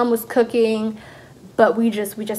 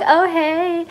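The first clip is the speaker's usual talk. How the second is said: high, drawn-out retold hello; mock pleasantly surprised greeting; recognizing greeting; 7 semitones higher than usual. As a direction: answer in a lifted, singsong voice. Acting surprised reply to aunt.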